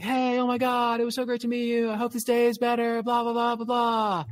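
high, sing-song mock-gushing voice; gushing text. Date's morning text, read high in mock gush. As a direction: read her text like an overeager fan. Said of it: "this message".

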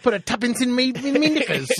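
very high, loud mock Cockney; over-the-top cartoon Cockney; Cockney accent. Shown as a demonstration. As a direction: squawk it in a hammed-up Cockney accent. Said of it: "My Cockney accent".

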